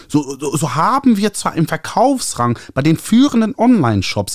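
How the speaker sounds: high, nasal, gushing publisher voice. A narrator's reading. Publisher Ernie calling the author, high nasal gushing. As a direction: trumpet it through your nose like an eager publisher. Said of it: "anstrengendes Näseln verschlimmerte".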